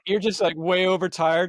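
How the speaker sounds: ordinary talk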